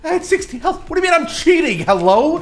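very high, loud disbelief; indignant protest. Real, not acted. Accused of cheating by a friend, high indignant protest. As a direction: squawk back in high-pitched outrage.

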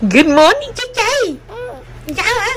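high, bright morning greeting; sing-song greeting. Real, not acted. Mother greets waking child. (chào buổi sáng giọng cao ngọt)

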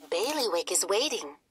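ordinary delivery

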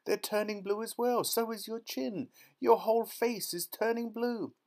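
very high, panicked shrieking; screamed. A narrator's reading. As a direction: wail each discovery in horror. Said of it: "screamed Missus Beauregard".

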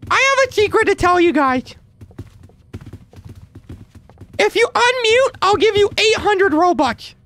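put-on Timmy kid voice. Troll luring players to unmute as a kid. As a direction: sweet-talk like a sneaky little boy. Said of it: "let me see if I can trick them".

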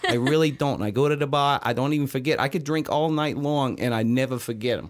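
broad, put-on Boston barfly; Boston accent. Shown as a demonstration. Bragging about all his voices on request, Boston accent.

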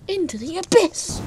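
high, loud hammed-up battle cry; dramatic cry. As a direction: fling it out like an epic hero. Jumping off, dramatic.

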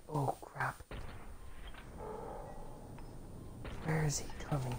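hushed whisper under pressure. Real, not acted. Gamer whispering to himself mid-fight. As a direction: whisper it like hiding from enemies. Tense moment in shooter game.

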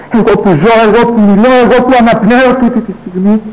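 high, loud, rising anguished cry; anguished outcry. Acted. Son crying out over his dying mother, anguished and loud.

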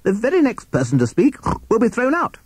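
high, strained, indignant judge; high-pitched snorting courtroom rebuke; snorting pig judge. A narrator's reading. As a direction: lay down the law with a piggy snort. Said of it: "he snorted".

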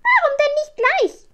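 very high, loud little witch; zufriedene kleine Hexe. A narrator's reading. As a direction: crow it high and satisfied. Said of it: "sagte die kleine Hexe zufrieden". (hoch und laut, zufriedene Hexe)

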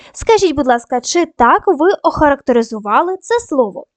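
usual speaking voice

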